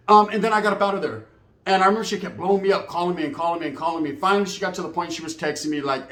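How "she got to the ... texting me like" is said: normal talking voice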